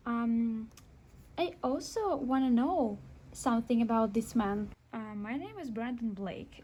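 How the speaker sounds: ordinary talk, nothing marked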